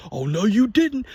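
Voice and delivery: mock big brother scolding. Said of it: "he's always like".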